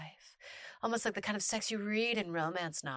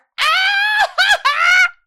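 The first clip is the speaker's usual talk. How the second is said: loud laugh; 18 dB louder than usual. Real, not acted. Reacting to shocking story line.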